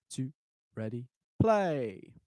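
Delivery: drawn-out cue to start; slow count-in. Shown as a demonstration. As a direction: stretch out ready, then play. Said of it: "count-in cue".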